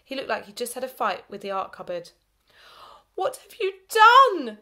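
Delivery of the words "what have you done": high, loud slow scream; teacher screaming at the ruined pet. A narrator's reading. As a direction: scream it in horror. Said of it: "screamed Missus McDonald again".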